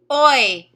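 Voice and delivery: oi stretched and said loud on its own; sounded-out oi. Shown as a demonstration. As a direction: isolate the vowel oi for the class. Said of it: "Let's practice a little bit together".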